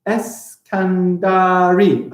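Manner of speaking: very slow "Alessina Eskandari", pausing between the names; deliberate, stretched syllables. Shown as a demonstration. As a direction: count out "Alessina Eskandari" beat by beat. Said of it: "I think I got eight".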